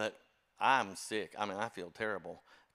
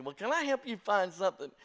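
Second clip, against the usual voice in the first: high, beaming shopkeeper greeting, much higher and louder; overly cheerful clerk; 9 semitones higher, 5 dB louder than usual. Retold or imitated. Liquor store clerk greeting the church staffer, beaming and high. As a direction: sing it out like a delighted salesman. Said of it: "suddenly he was so happy".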